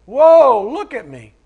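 loud, high "Whoa" that drops off; hammed-up vain exclamation; mock self-admiring. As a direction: gasp at your own reflection. Mocking a self-admiring believer.

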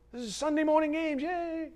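mock the idea of church as a game; sarcastic cheer. Mocking "Sunday morning games.

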